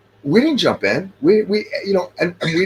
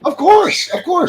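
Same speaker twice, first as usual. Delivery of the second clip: very high-pitched exclamation; real "obviously!" outburst; emphatic agreement; slower than usual. Real, not acted. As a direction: jump in with high, incredulous agreement. Exclaims agreement over the other speaker.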